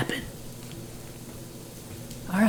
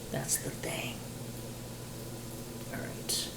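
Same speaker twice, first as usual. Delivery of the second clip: whisper; 3 semitones lower, 16 dB quieter, slower than usual. Real, not acted. Muttering aside while cooking.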